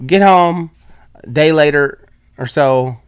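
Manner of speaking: ordinary talk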